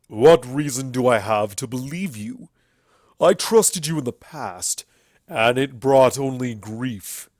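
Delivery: loud, bitter sneer. A narrator's reading. Everfree sneering at the sisters, loud and bitter. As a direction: spit distrust at the old rulers. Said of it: "as he sneered at the new arrivals".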